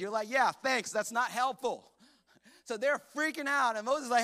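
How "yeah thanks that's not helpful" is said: loud, higher-pitched sarcastic thanks; sarcastic retort. Acted. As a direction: push back on useless advice. Annoyed reply to useless advice.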